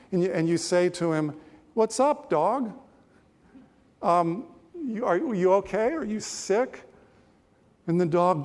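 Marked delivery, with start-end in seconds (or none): 1.7-6.8 concerned questioner. Acted. Preacher voices listener asking dog.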